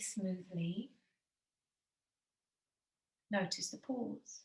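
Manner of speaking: calm yoga teacher pacing the breath; guided meditation. Acted. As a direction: ease them through each breath.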